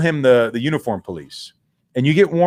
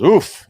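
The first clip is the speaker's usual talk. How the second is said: dad's pained oof; 6 semitones higher, 7 dB louder than usual. Retold or imitated. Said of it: "my old man goes".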